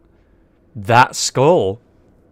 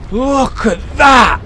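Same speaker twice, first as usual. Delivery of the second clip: amazed shout; 9 semitones higher, much slower than usual. Real, not acted. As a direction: cry out at the sight. Shocked at the boss.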